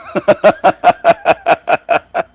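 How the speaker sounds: high, loud villainous cackle; gloating laugh. Real, not acted. Laughing down at the demons, a gloating cackle. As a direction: laugh like you've already won.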